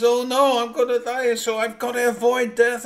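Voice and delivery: loud, high, frantic cry of fear; he sees death pointing at him and panics; panicked cry. Acted. Man fleeing death, panicked.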